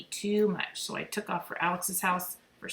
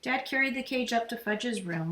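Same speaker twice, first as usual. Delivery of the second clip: plain reading voice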